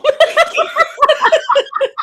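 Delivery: loud, high burst of laughter. Real, not acted. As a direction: crack up laughing. Laughing at her own line.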